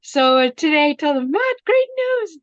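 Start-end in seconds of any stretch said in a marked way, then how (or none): 1.3-2.4 excited announcement. Retold or imitated. Telling son the good news.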